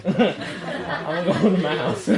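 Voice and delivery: slow words through a laugh; bow out while cracking up; drawn-out, giggly mock exit; laughing retreat. Jokes about escaping the couple's quarrel.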